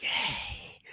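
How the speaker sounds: put-on whispered creepy old lady; breathy crone hey. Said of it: "a creepy w-, old lady voice".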